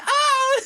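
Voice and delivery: high laughing shriek; mock yelp of pain, laughing; old lady scream. Old lady punched away, high laughing scream. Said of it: "she's like".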